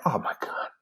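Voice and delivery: whispered, stunned reaction; dismayed near-whisper; hushed dismay. Retold or imitated. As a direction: say it under the breath, barely voiced. Reacting to bad news.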